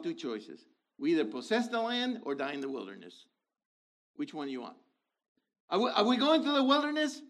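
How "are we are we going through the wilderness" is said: challenging question. Real, not acted. Preacher challenging congregation loudly.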